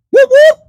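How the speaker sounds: high, loud siren whoops; imitated police siren. Storyteller mimicking the police pulling up, high siren whoops.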